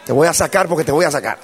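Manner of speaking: loud, high-pitched sermon delivery; full-force preaching. Real, not acted. Preacher tells the congregation the conclusion, preaching at full force. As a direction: preach it with force to a big crowd.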